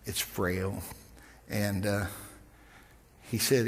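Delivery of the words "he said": ordinary delivery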